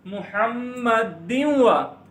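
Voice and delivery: मुहम्मदिन वा joined as "muhammadiw-wa" with nasal hum; merged noon. Shown as a demonstration. Teacher shows idgham into waw, merged and nasal. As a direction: melt the n of मुहम्मदिन into the w. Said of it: "वा से बन जाएगा".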